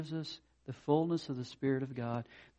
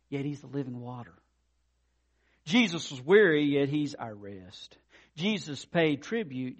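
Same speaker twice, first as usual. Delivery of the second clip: louder, measured preaching cadence; 4 semitones higher, 7 dB louder than usual. Real, not acted. Preacher recites paradoxes of Jesus to congregation, proclaiming. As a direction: land each contrast with weight.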